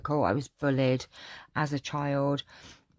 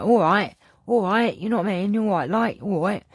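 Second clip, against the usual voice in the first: loud, higher-pitched Cockney banter; matey Cockney, louder than usual; 5 semitones higher, 5 dB louder than usual. Retold or imitated. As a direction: ham up a Cockney accent. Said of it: "sometimes I'm like".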